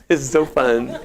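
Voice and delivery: lightly mocking a feeble exerciser; mock half-hearted fun. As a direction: show how not to do it, playfully. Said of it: "you're not gonna go like this".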